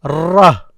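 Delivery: "Ra" with a held, rolled R before the vowel; "Ra" sounded alone as rr-ra; doubled R. Shown as a demonstration. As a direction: roll into "Ra" on a doubled R. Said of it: "The first R is silent".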